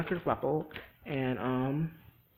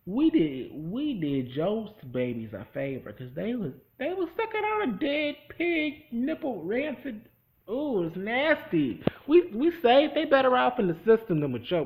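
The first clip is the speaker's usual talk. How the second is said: much higher, mocking put-on voice; sassy lecture; 9 semitones higher, 8 dB louder than usual. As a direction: scold them with exaggerated disgust. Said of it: "I would have been like".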